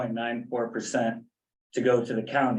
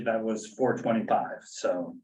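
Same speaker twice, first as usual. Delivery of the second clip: ordinary delivery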